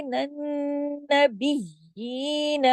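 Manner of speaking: "नबिय्यीन" slow with full nasal ghunna; recitation demo. Shown as a demonstration. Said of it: "इसका पूरा हक अदा करना है".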